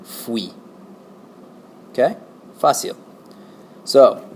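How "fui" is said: "fui" as a single syllable, fwee; correct fui. Shown as a demonstration. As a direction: put an F straight onto "we" for "fui". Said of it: "put an F in front of the word we".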